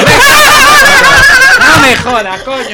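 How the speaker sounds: loud disbelief; exasperated. Real, not acted. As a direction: blurt out in disbelief. Exasperated at title.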